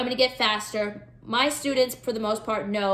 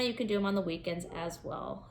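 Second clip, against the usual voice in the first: normal talking voice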